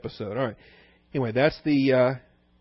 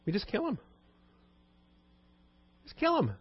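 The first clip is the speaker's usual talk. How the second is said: callous plotting; 10 semitones higher, 6 dB quieter than usual. Retold or imitated. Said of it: "He says".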